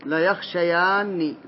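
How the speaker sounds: teacher repeats لا يخشيان slowly to confirm it. Shown as a demonstration. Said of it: "أبا المنذر".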